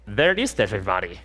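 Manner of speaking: put-on lightly done German accent, pitch up; hint of a German accent, hammed-up; bad German accent. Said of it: "It's, it's a bad German accent".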